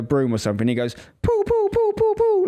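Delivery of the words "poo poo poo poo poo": high squeaky pretend gunfire; child gun noises. Dad imitating son's broom gun, high squeaky pops. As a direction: go pew pew like a toy gun. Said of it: "like a gun".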